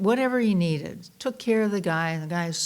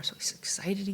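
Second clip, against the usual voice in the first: unvoiced breathy aside, much softer; whisper; 9 dB quieter than usual. A narrator's reading. Minister telling the congregation a story, whispered. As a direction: drop to a hushed whisper, building suspense.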